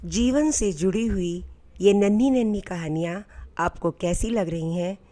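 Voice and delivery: ordinary delivery, nothing marked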